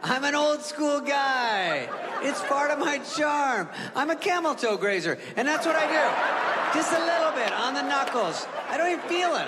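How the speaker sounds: hammed-up creepy uncle bragging; smug old uncle, mock proud; old-school uncle. Uncle voice continues, higher.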